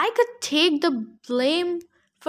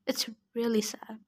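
sad line breathed out in a whisper; 6 semitones lower, 9 dB quieter than usual. Real, not acted. Reader repeats her sad reaction, whispering. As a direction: drop the voice to a bare whisper, saddened.

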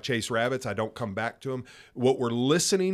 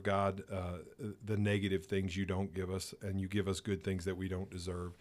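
soft, hushed prayer voice, dropping lower at the ends; quiet prayer; 4 semitones lower, 6 dB quieter than usual. Acted. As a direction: pray gently and reverently, almost to yourself.

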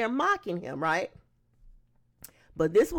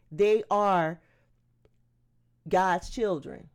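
ordinary delivery

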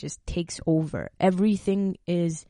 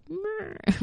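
put-on high, dazed, drunk-feeling meh; a numbed groan as if behind glass; 14 semitones higher, 5 dB quieter than usual. Describing a panic attack's haze, in a dazed meh. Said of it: "glass over your eyes and you're like".

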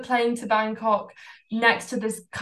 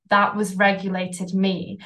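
usual speaking voice

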